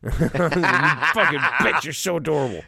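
mock outburst. Mock outburst at cute girl.

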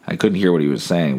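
ordinary talk, nothing marked

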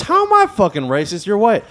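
incredulous retort in a retold quarrel; retold indignant retort. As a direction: fire back, imitating an offended woman.

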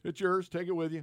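lightly voiced generous owner; generous gift. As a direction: hand it over with a flourish. Generous owner gives book.

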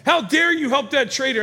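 high, loud accusing yell; retold angry yell. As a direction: erupt at them as an outraged king. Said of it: "he yells".

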